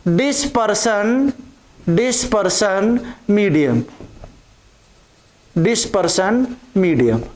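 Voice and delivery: slow, stretched syllables with pauses; slow dictation. Real, not acted. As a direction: repeat the term slowly for note-takers. Dictating a term while writing it. (धीरे-धीरे शब्द लिखवाते हुए)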